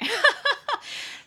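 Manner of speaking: high, bursting giggle; real laugh. Real, not acted. Teacher amused at her own vanity, real high laugh.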